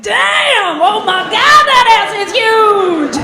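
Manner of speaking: loud, high, excited hollering; mock hyped street shout; catcalling. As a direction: whoop it like a New York catcall. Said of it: "guys were like".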